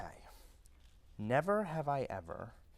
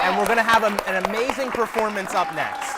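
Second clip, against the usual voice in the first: host announcing; 4 semitones higher, 10 dB louder than usual. Real, not acted. Host announces next segment.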